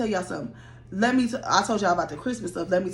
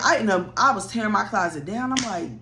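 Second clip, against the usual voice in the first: usual speaking voice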